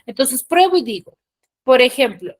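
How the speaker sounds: ordinary talk, nothing marked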